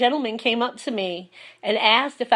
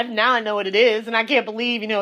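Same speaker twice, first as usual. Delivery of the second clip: ordinary talk, nothing marked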